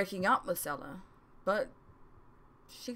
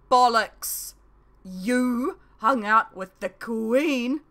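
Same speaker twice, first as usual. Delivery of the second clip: loud, slow, high disbelief; loud incredulous outburst; shocked shout; 5 semitones higher, 9 dB louder, much slower than usual. A narrator's reading. Friend shocked at the news.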